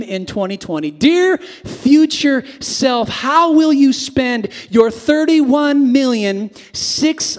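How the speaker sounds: slow, loud, grand reading; slow grand proclamation; dramatic address. Acted. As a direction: read it like a solemn letter to yourself.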